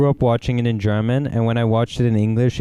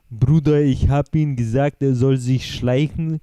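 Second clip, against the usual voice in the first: slow mock immigrant-accented street German; imitating foreign-accented German; slower than usual. Imitating passers-by who are not from Austria, foreign-accented German. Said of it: "when they talk like that".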